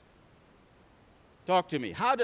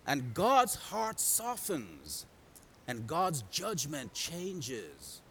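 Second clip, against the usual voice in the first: slow, soft, stretched-out words; hushed, unhurried preaching; solemn softening; 2 semitones lower, 6 dB quieter, much slower than usual. Real, not acted. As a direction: draw each phrase out gently, with weight. Solemn turn to God's mercy.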